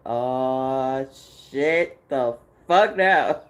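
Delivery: slow, loud laughing groan; laughing exasperation. Retold or imitated. Answering God again, loud laughing exasperation. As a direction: laugh it out, fed up.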